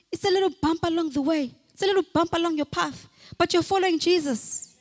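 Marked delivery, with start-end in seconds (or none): none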